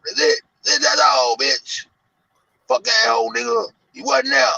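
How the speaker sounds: high, loud hammed-up threats; furious rant. [name] ranting at Zach on video, shouted high and loud. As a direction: yell it like he means it.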